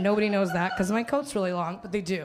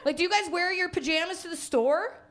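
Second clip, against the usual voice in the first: ordinary talk, nothing marked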